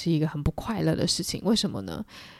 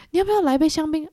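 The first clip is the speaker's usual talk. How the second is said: eager host offering; 9 semitones higher, 4 dB louder, faster than usual. Retold or imitated. Gatsby eagerly offering drinks.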